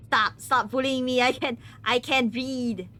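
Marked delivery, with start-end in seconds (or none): none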